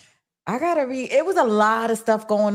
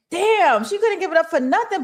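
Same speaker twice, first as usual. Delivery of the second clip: exasperated; 8 semitones higher than usual. Real, not acted. Fan venting about Rock, high and exasperated.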